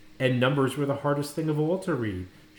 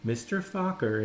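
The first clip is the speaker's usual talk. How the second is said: ordinary delivery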